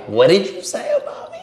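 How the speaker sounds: high, hammy accusing line; overacted confrontation. Shown as a demonstration. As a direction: ham it up like a bad actor. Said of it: "I always thought you had to".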